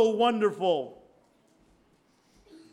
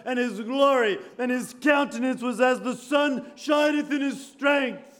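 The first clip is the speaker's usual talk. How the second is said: preaching shout; 5 semitones higher, 4 dB louder than usual. Acted. Preacher repeating verse with force.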